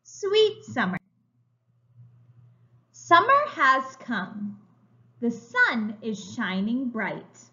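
ordinary talk, nothing marked

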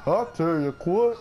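lightly drawled Texan "I'll tell you what"; a hint of a Texas propane salesman; Hank Hill impression. Shown as a demonstration. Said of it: "everybody do their best Hank Hill impression".

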